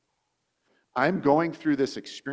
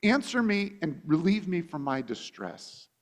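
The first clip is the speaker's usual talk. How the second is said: David's plea; 7 semitones higher, 5 dB louder than usual. Retold or imitated. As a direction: plead with God to act now. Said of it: "but he says".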